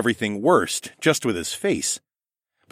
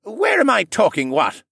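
put-on high, louder, befuddled old man; confused drunk koala; 12 semitones higher, 4 dB louder than usual. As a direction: lose the thread drunkenly.

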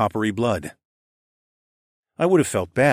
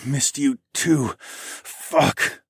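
grunted; 5 semitones higher, much slower than usual. A narrator's reading. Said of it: "I grunted".

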